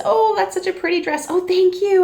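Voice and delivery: high, delighted small-talk voice; gushing compliment. Acted. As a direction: act out a delighted compliment and thanks. Acted compliment and delighted thanks.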